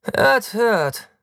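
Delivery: very high, loud cheer; hammed-up cry of assent; hearty agreement. A narrator's reading. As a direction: call out approval, loud and high. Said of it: "stimmt Taktus zu".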